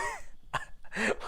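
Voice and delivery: quick little chuckle; short laugh. Real, not acted. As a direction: laugh it off lightly. Laughs at own cowboy attempt.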